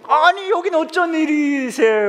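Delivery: high, loud, slow, stretched-out pleasant surprise; long stalling greeting. Acted. Pastor fishing for clues from a member, drawn-out greeting. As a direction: drag out every word in mock delighted surprise. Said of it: "이것도 길어야 돼".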